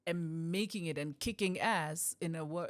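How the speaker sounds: ordinary talk, nothing marked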